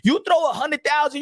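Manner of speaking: ordinary talk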